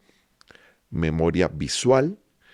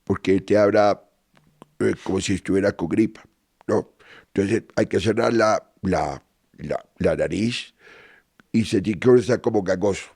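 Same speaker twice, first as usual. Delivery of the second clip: imitating Santos. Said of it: "es la de Santos".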